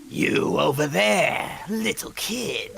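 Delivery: villain voice. Acted. Ganondorf voice roleplay.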